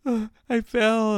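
high, slow whimper; mock a tearful confession; retold crying. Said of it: "she's fucking crying".

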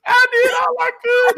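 very high, hammed-up sobbing wail; mock crying. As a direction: break into a squealing fake cry. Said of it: "when you cry in the car".

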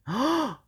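sharp high gasp; dramatic gasp. Acted. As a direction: gasp in surprise. Shark spots the lion.

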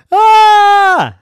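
loud, long high-pitched scream. A narrator's reading. Maven hearing something in the cave, high loud scream. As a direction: shriek suddenly at full voice. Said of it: "She was screaming".

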